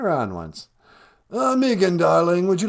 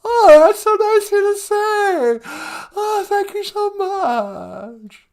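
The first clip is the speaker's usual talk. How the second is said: much higher, louder, gushing caricature of Megan; mock Megan voice; 6 dB louder, slower than usual. Host plays Megan on the phone, high gushing squeal. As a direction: squeal it like an overexcited fan.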